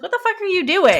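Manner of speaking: high-pitched, incredulous retelling of a startled question; mock startled outcry. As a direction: imitate someone woken up baffled, breaking into laughter. Voicing a woman woken by commotion.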